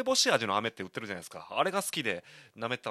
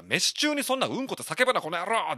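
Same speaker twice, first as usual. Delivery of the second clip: retold angry yell; 9 semitones higher, 6 dB louder than usual. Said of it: "食ってかかってやった".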